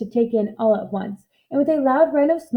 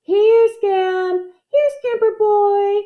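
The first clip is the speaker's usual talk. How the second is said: much higher, louder call from afar; calling a dog; 10 semitones higher, 4 dB louder, much slower than usual. Acted. Boy calling for his lost dog down the road, loud. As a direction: call your dog across the fields. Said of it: "he heard Orby calling".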